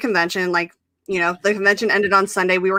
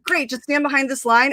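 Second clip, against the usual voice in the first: higher-pitched quote of film crew; retold crew member; 6 semitones higher than usual. Crew spotting familiar fans on set, lightly imitated greeting. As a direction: lightly voice an easygoing set assistant.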